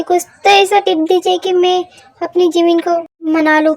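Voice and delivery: ordinary talk, nothing marked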